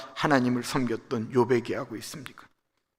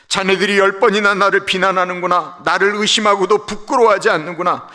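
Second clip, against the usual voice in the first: loud, high, stinging retort to friends; Job's bitter reply; 6 semitones higher, 7 dB louder than usual. Acted. Job answering his friends, loud and bitter. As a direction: hurl it back at the accusers.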